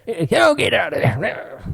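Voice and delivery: high, slow, stretched-out scolding; old person impression. Joining in the old-person dog bit, slow cranky scolding. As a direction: drag it out like a cranky elder. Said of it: "You're like an old person".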